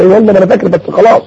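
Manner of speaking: ordinary delivery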